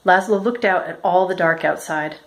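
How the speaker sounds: usual speaking voice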